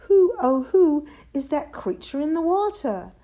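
high, loud amazed hoot; wondering question. A narrator's reading. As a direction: ask in wide-eyed wonder. Drip asks in wonder at reflection.

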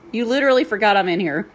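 fast, laughing flat statement of disbelief; laughing exasperation. Retold or imitated. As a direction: rattle it off, amused and fed up. Retold reply to noisy peer.

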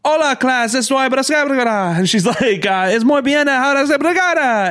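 high, loud gibberish Spanish; mock Spanish teacher. Imitating the Spanish teacher, high gibberish. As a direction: rattle off fake Spanish like the teacher. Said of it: "I didn't fucking get it all".

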